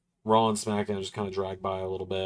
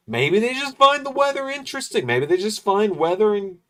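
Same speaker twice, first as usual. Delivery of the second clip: exasperated; 10 semitones higher, 4 dB louder than usual. Real, not acted. Exasperated rant about ads.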